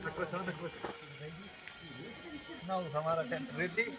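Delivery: ordinary delivery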